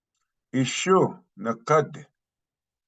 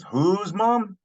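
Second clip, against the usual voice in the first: high-pitched query on "Whose mom?"; puzzled follow-up question, pitched well up; questioning listener; 10 semitones higher than usual. Acted. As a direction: ask "Whose mom?" as if you missed it, pitch jumping up. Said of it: "the person says".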